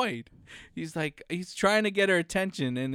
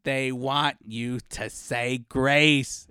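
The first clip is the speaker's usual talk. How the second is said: slow explaining; 6 semitones lower, much slower than usual. Retold or imitated. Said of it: "He's like".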